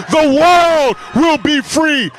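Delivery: ordinary talk, nothing marked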